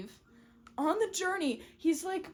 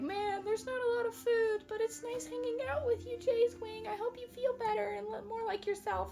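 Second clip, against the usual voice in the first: put-on high, quick, softer girl voice; high sweet girl; 10 semitones higher, 4 dB quieter than usual. Half Moon chatting fondly to Jay's Wing, high and quick. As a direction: chatter sweetly to the boy you like.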